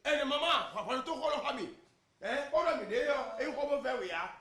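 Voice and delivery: high, loud public holler; shouting. Acted. Husband carries out his threat to shout at the mothers, hollering. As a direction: cry it out for everyone to hear. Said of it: "je vais crier".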